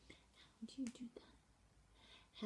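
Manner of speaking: whispered, slow, questioning; mouthed question. Shown as a demonstration. Teacher mouthing question with questioning face, whispered. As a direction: breathe it silently, asking. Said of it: "you see my face".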